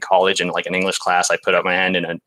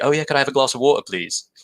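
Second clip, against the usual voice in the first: put-on English accent; 6 semitones higher, faster than usual. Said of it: "realizing I was English".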